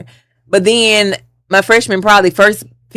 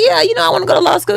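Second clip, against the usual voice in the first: put-on much higher and fast, eager young voice; imitating her younger self; 14 semitones higher, faster than usual. Student answering the professor, high and fast and eager. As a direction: gush it out pitched way up. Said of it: "And I'm like".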